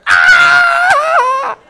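loud high despairing yell; anguished scream. Retold or imitated. Alone at the truck, letting out a loud despairing scream. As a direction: let out a loud wail of despair. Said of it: "run back to that".

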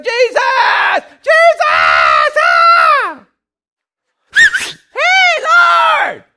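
wild, high-pitched full-force shouts; crazy yelling. Acted. Blind beggar trying to get Jesus' attention, yelling wildly. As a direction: yell like a crazy fool desperate to be noticed. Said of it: "just start yelling like a crazy fool".

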